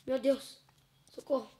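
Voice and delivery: yelping for help in real fright; panicked cry for help. Real, not acted. Scared in a horror game. (grito de socorro assustado)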